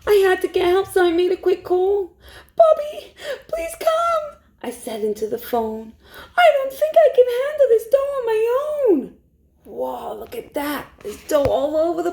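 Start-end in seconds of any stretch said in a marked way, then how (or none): 2.5-4.4 high, urgent plea down the phone; frantic call to Bobby, much higher; panicked plea. A narrator's reading. 6.4-9.2 fast, high, teary rush of words; near-crying call for help, much higher and fast; frantic plea. A narrator's reading. Panicked phone call for help.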